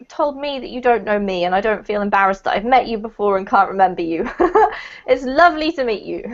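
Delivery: ordinary talk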